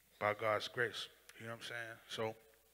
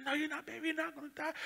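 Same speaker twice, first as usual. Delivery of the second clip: high, tender mother's voice; comforting mother; faster than usual. Retold or imitated. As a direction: reassure him like a frightened mom.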